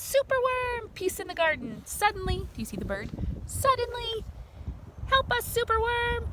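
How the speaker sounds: high, dramatic superhero announcer; high hammed-up comic-book drama; comic hero voice. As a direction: narrate it like a cartoon adventure.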